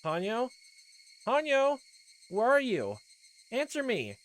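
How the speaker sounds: high, loud desperate calling. Acted. Rika calling for Hanyo, frantic shout. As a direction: shout into the dark for her friend.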